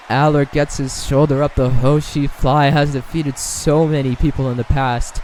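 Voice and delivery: ordinary talk, nothing marked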